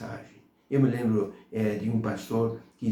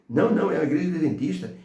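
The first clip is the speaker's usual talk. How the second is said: quick, higher-pitched protest; emphatic correction; 6 semitones higher, 4 dB louder, much faster than usual. Retold or imitated. Church member correcting the blind man, hastily protesting. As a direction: rush to set the record straight, imitating an indignant denial.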